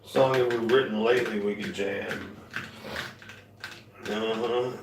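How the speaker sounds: ordinary talk, nothing marked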